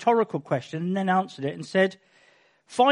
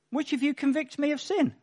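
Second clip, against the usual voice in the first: challenging question; 7 semitones higher, 3 dB quieter than usual. Acted. Jesus challenging accusers.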